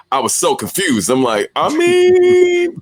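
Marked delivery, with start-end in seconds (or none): 1.6-2.8 high, drawn-out puzzled reaction; mock confusion. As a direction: stretch it out high, acting baffled. Acting out his confusion at friend.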